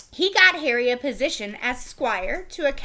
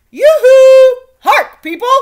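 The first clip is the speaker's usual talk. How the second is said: much higher, much louder sing-song call; crier calling; 13 semitones higher, 20 dB louder than usual. A narrator's reading. Royal crier summoning the court, loud high call. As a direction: hail everyone like a herald.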